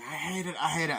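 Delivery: high, loud, repeated I hate it; frenzied hate. Retold or imitated. Mother in her frenzy, shrieking. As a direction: spit it out twice, losing control.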